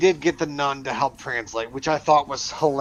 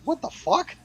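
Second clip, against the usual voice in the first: a sudden, much higher what the fuck; shocked outburst; 12 semitones higher than usual. Retold or imitated. Learning his friend speaks French, shocked outburst. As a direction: caught off guard, blurting it out. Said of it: "I was like".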